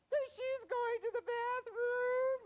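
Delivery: hammed-up bawling little kid, drawn out; high, loud mock tearful whine; crying child. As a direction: wail the answer slowly, very high and loud. Acted crying child answers agent.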